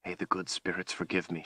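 very soft, hurried prayer; whispered. A narrator's reading. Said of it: "she whispered".

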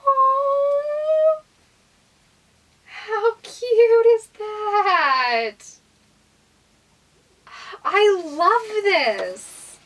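high, slow delighted squeal; excited squeal. Real, not acted. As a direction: gush over a cute gift.

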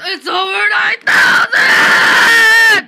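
very loud, high, slow scream; meme scream. Pretend. Boy screams the over nine thousand meme back, very loud.

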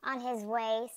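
usual speaking voice

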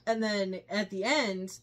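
normal talking voice

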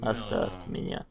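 whispered sulky plea. Acted. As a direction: breathe it out, defeated. Sulking loser. (шёпотом, обиженно)

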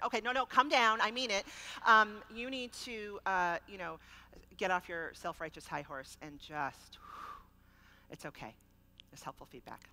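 stern inner voice, then softening; firm self-command. Shown as a demonstration. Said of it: "I have to say".

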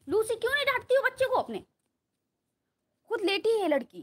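high, loud playful telling-off; loud scolding. Real, not acted. Scolds Lucy from afar. (ऊँची आवाज़ में डाँटते हुए)

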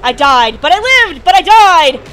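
loud mock drama.

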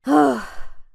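loud, exasperated exhale; weary huff; heavy sigh. A narrator's reading. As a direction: let out a big dejected sigh. Sighs over being shut out.

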